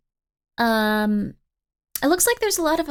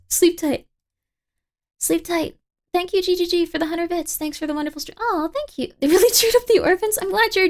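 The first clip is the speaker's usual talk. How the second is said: cheerful; 6 semitones higher than usual. Real, not acted. Cheerful sign-off, high.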